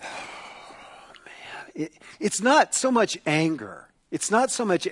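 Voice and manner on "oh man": deep sigh into very soft words; grieved sigh. Acted. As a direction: sigh it out in acted grief, barely voiced. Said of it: "he sighed deeply in his spirit".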